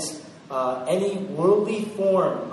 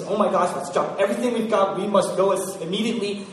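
loud, hurried and urgent; urgent rush; 3 semitones higher, 7 dB louder than usual. Pretend. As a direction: drop everything and hurry. Jesus voiced rushing in sermon.

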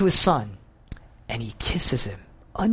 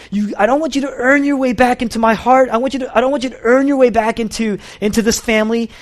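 raised, intense and pleading, running on; father's passionate plea; 8 semitones higher, 5 dB louder than usual. Retold or imitated. Father to his lost son, raised passionate plea. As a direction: beg the son not to earn his way back.

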